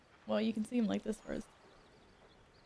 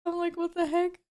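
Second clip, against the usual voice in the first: high laugh into a louder what the heck; laughing outburst; 9 semitones higher, 6 dB louder than usual. Real, not acted. Streamer laughing at her own audio mishap, high and loud. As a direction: laugh it off in disbelief.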